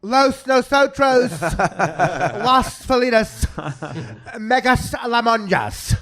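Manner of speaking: high, slow effeminate voice with mock Spanish; hammed-up effeminate fake Spanish, high and slow; Tim Kaine impression. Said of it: "when he talks Spanish".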